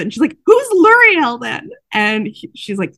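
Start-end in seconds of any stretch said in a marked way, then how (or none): 0.5-1.7 high, loud, slow accusing shout; losing it. Retold or imitated. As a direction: explode with jealousy. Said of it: "loses it".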